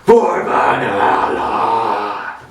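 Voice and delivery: slow, dragged-out war cry; battle cry shout. Acted. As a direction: bellow it like a viking charging.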